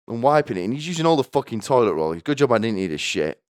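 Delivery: usual speaking voice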